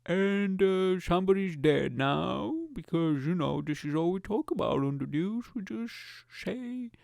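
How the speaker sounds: slow, high, drawn-out presenter voice; cartoonish dragged-out bulletin; mock newsreader. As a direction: read the news slowly in a silly voice. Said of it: "learn how to do more voices".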